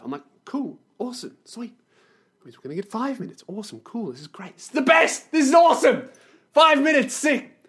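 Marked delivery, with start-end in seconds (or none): 0.5-4.5 very soft, barely-there enthusiasm; feigned contentment. Retold or imitated. Feigning cheer over shrinking interview time. 4.5-7.6 high, loud sarcastic enthusiasm; mock delight. Mock delight at five minutes.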